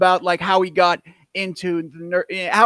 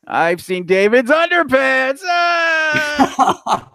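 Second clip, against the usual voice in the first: high, loud playground-taunt yell; mock yell ending in a shriek; shouted punchline; 7 semitones higher, 3 dB louder, much slower than usual. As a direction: belt out the rhyme's last line like a schoolkid.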